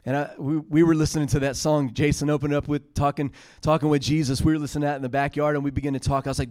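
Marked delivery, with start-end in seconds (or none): none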